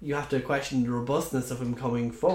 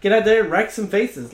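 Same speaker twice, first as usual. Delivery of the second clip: higher, louder hype shout; mock coach yell; 8 semitones higher, 8 dB louder than usual. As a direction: fire up the team like a coach. Mock coach pep talk.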